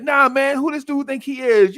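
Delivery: high, loud incredulous jeer; indignant crowd voice. Retold or imitated. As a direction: scoff like a bystander who can't believe this guy. Voicing the gym crowd's outrage.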